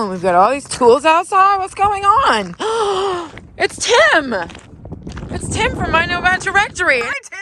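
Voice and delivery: high, loud, thrilled greeting; excited. Real, not acted. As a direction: burst out with delight. Friend arrives, excited greeting.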